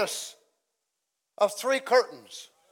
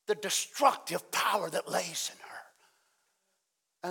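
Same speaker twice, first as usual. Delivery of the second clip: much softer, near whisper, then whispered; hushed; 4 semitones lower, 11 dB quieter, slower than usual. Acted. Pastor hushing the church over Babylon, near whispered. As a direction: draw them in with a low hush.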